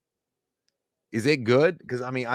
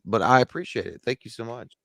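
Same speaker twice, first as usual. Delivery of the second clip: normal talking voice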